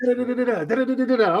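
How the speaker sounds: fast, high-pitched rattling babble; rapid babble. Retold or imitated. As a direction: rattle off nonsense syllables at speed, mimicking a nonstop talker. Mimicking fast talker.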